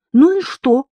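high, loud comeback; insistent. A narrator's reading. Marishka refusing to drop her idea, insistent. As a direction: fire it back, undeterred. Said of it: "не унималась Маришка".

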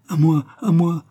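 high, loud plea for help; wounded woman calling out, acted in narration; cry for help. A narrator's reading. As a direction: call out urgently for rescue. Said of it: "faisait une femme qu'une balle".

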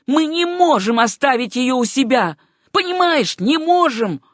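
high-pitched, forceful outburst; almost shout the refusal, voice pushed up; furious near-shout. A narrator's reading. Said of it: "бешено повернулся к Стивенсу и почти крикнул".

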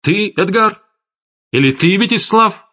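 single them out, voice climbing high. A narrator's reading. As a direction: Gesser rounding on each accused in turn. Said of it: "повышая голос".

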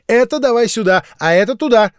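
put-on a busy little girl bossing; bossy commands. A narrator's reading. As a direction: order playmates around impatiently. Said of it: "командовала мышка".

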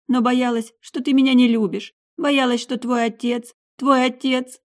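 mother pleading through tears; a tearful mother's rising plea; tearful confession. A narrator's reading.

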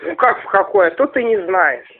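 usual speaking voice

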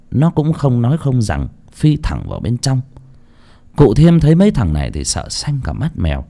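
usual speaking voice

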